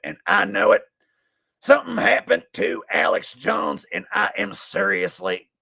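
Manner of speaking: ordinary talk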